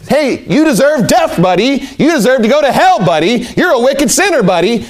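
sarcastic mock sermon, leaning on each buddy; mock browbeating. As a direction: lay it on thick like a preachy know-it-all lecturing a sinner. Mock preacher browbeating a sinner.

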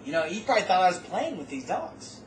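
usual speaking voice

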